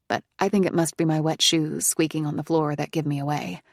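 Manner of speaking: usual speaking voice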